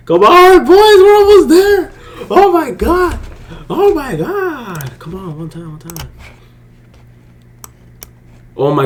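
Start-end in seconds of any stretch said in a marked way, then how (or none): 0.1-5.0 much higher and louder, urging the trade on; excited cheering. Real, not acted. Trader urging his trade to hit while viewers watch, excited high cheering. 5.0-6.1 much softer, pleading under the breath; tense hush. Real, not acted. Trader begging the chart to hit target, tense hushed pleading. Tense wait for take profit.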